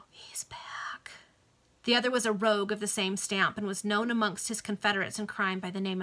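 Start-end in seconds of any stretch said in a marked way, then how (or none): 0.1-1.1 breathless whisper, far away; hushed eerie whisper. Acted. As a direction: breathe it like a ghostly secret. Spooky aside during story.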